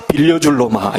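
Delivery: ordinary talk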